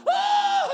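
loud, very high imitated shriek; awestruck mock scream. Imitating the family seeing the house, loud shriek. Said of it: "it's just like".

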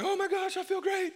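high giddy imitation of a healed leper; giddy excitement. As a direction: squeal it like a healed man who can't believe it.

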